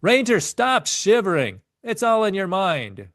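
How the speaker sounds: high, loud barked order from a drill instructor; instructor yelling. A narrator's reading. Drill instructor yells at the freezing swimmer, high loud bark. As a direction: bark it at the shivering ranger. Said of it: "the instructor yelled".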